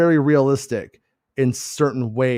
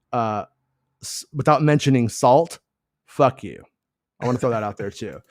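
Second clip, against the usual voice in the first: ordinary talk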